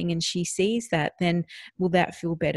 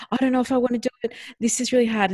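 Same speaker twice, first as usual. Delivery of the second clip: imitated fretful child, pitched higher; reluctant child; 5 semitones higher, 3 dB louder, faster than usual. Daughter balking at swimming lessons, imitated reluctance. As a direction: voice a child's worried resistance, raised pitch. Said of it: "there was still some more".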